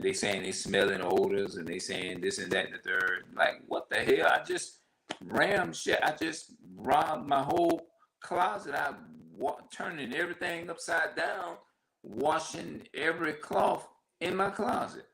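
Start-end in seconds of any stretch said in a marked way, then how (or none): 4.3-15.1 worked-up rant. Real, not acted. Frustrated about endless demands.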